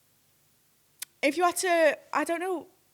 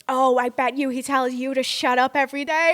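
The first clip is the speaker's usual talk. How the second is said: imitated taunt; 3 semitones higher, 6 dB louder than usual. Mimics drunk fan goading him.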